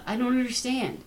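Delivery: high, plaintive reenacted confusion; retold tearful bewilderment. Artist hugging the buyer at the show, retold tearful bewilderment. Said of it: "I cried and I sobbed".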